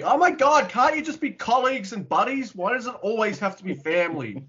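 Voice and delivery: high-pitched exasperated rant. Real, not acted. Co-host fed up with the movie cliché, exasperated.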